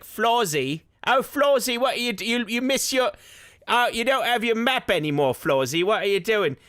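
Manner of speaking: high Cockney voice scolding the robot vacuum; fussing over Florsy in a high Cockney accent. Pretend. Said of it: "he turns a little bit more cockney".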